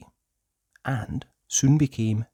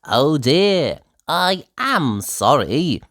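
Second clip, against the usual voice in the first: slow, louder mock apology; drawn-out sly sorry; Mister Brush apologizing; 5 semitones higher, 5 dB louder, slower than usual. Painter Mister Brush fake-apologizing to Nosey, slow. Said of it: "said Mister Brush".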